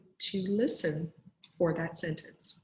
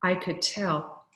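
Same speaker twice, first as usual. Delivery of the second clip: lively read-aloud to a class; animated children's story reading; storytelling voice; 2 semitones higher, 5 dB louder than usual. Acted. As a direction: read it like a picture book to kids. Teacher reads story aloud to class.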